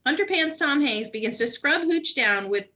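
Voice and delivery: ordinary talk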